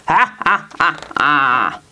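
mad scientist cackle; crazed cackle. Acted. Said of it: "I'd know that crazed cackle anywhere".

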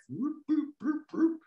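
high robot whoop whoop, like a little alarm; robot siren. Pretend. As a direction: sing it out like a tiny robot siren. Drawn robot makes siren sounds.

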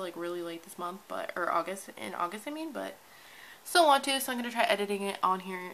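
ordinary delivery

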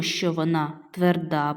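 ordinary delivery

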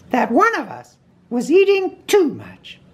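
very high character voice; Rabbit. A narrator's reading. Rabbit answering his guest Pooh, very high voice. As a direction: pitch it way up, small and proper.

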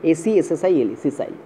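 সিসাইল spelled letter by letter, softly, then said; teacher spelling the English word for the class. Shown as a demonstration. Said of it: "spelling sessile".

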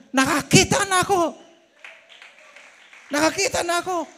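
high, loud cry of joy; shouting joyfully. Retold or imitated. Healed blind man shouting to the crowd after baptism, loud and high.

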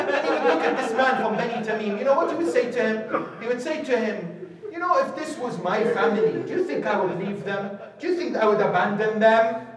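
fast, loud, high sermon drive; fast, loud pulpit questioning; rushing preaching. Acted. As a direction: press the questions on the listener quickly. Preacher speeds up loudly.

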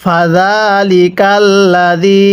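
drawn-out Arabic recitation, slow; slow Quran recitation. Shown as a demonstration. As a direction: recite slowly for students to repeat. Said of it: "তাহলে কিভাবে পড়ব?". (ধীরে কুরআন তিলাওয়াত)

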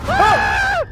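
sudden high scream of fright; a sharp yelp, caught off guard; startled scream. Real, not acted. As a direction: shriek at the jump scare. Jump scare under bed.

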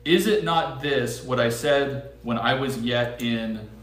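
slow emphasis. Shown as a demonstration. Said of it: "Listen real carefully to that statement".

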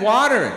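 "water" with an American flapped t and final r; American pronunciation. Shown as a demonstration. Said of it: "the big difference between water".

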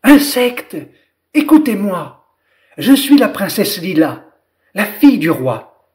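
high, loud call to the crowd. Acted. Lila calling all the insects, loud call. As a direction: project it from the mushroom. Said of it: "appela d'une voix forte".

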